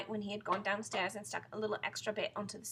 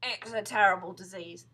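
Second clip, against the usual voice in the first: loud, ominous verdict; grave husband; 11 dB louder than usual. Acted. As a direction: pronounce doom on her. Husband frightens wife in story.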